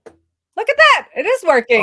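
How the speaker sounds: high, loud, slowed cry of delight; thrilled. Real, not acted. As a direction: crow over the working code. Code finally works on stream.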